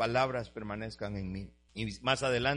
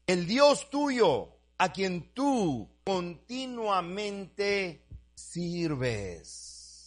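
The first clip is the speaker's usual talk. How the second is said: higher, very slow, drawn out; slow sermon emphasis; 5 semitones higher, much slower than usual. Acted. Preacher reads the king's words to Daniel, very slow and drawn out. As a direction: lean on each word of the king.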